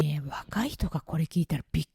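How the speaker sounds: conspiratorial hush; hushed aside. Real, not acted. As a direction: lean in and whisper it to the audience. Aside to young listeners.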